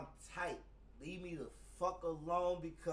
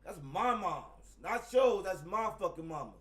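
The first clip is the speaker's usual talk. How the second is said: retold yelling; 4 semitones higher, 8 dB louder than usual. Continuing the confrontation.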